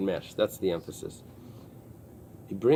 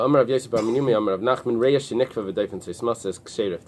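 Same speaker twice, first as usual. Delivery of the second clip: quick, louder study-hall text reading; Gemara reading; 4 dB louder than usual. Acted. Teacher reading the Gemara line aloud to students, quick and louder. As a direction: rattle off the Aramaic text like a learning chant.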